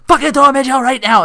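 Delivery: high, loud yelling order; furious demand. Retold or imitated. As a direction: shout it at the cops.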